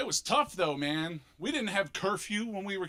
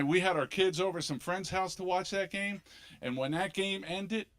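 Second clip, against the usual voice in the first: usual speaking voice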